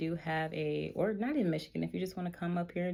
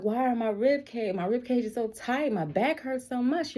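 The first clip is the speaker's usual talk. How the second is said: higher, louder whiny groaning; whiny complaint; 7 semitones higher, 5 dB louder than usual. Retold or imitated. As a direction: whine about the aches. Complaining about tight ribs.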